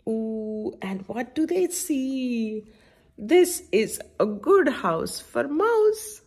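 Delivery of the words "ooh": usual speaking voice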